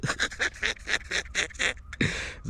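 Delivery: high airy laugh under the breath; short laugh. Real, not acted. Laughing at being stuck abroad, a high airy laugh. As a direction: let a quick laugh escape. Laughing after his own point.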